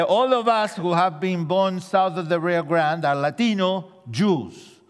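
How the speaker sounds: ordinary talk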